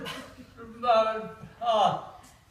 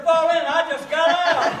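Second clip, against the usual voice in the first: louder, slower, higher indignant comeback; indignant retort; 6 semitones higher, 6 dB louder, slower than usual. Retold or imitated. Soaked soldier answering the order, loud indignant retort. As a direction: throw the order back at him in disbelief. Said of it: "I said".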